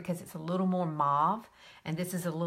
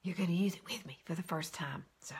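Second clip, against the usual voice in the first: whispered, softer; whispering to viewers about a new shade; excited whisper; 7 dB quieter than usual. Real, not acted. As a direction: whisper it like a secret.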